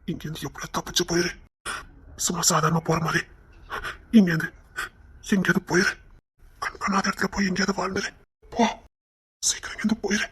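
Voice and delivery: very soft undertone, barely voiced; hushed urging. Urging the other to escape and live far away, hushed. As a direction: plead quietly for them to flee.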